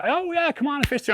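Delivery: very high, sing-song invitation; mock polite offer. Craig inviting him through, mocked very high. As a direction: imitate a too-sweet offer. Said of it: "he's, he's like".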